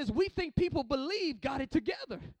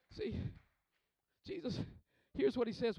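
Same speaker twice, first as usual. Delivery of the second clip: ordinary delivery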